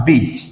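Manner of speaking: बीच with a lengthened ee; long vowel. Shown as a demonstration. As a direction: hold the ee in बीच as it falls. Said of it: "large या long sound में".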